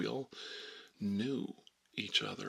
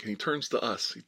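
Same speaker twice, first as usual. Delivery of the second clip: ordinary talk, nothing marked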